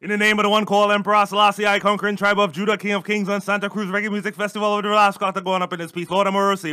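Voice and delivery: Jamaican dancehall toast, building to a shout; island intro. Acted. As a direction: hype it like a reggae MC. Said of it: "give your island intro".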